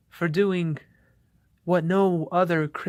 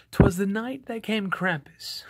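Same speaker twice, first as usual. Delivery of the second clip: dramatic, higher-pitched opening of a spooky poem; scary poem reading; 4 semitones higher than usual. Acted. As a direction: launch into a scary Christmas tale in verse. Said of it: "reading voice".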